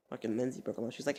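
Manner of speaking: normal talking voice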